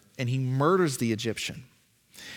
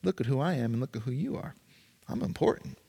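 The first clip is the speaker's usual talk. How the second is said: condescending; 5 dB quieter, faster than usual. Pretend. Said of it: "look down at other people and say".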